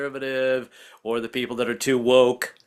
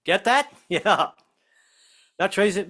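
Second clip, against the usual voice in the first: ordinary talk, nothing marked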